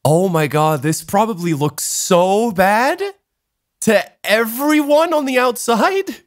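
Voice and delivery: retold realization, slowly climbing in pitch; dawning horror. Realizing how bad this looks to everyone, dawning horror. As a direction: draw it out, rising high as it sinks in. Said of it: "I completely freeze and realize".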